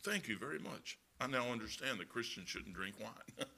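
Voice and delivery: quietly voiced calm friend; mock calm Tom. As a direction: imitate the friend's calm, sly reply. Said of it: "Tom went".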